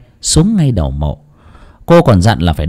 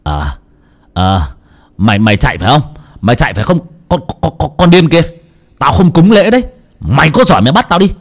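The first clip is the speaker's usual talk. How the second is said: louder, fast defiant taunt; defiant yelling; 5 semitones higher, 3 dB louder, faster than usual. A narrator's reading. Husband taunting the fleeing spirit, defiant yell. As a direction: dare the spirit to catch you.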